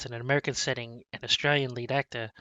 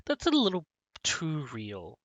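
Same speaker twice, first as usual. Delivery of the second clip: uneasy remark; 4 semitones higher than usual. Retold or imitated. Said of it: "I'm just like".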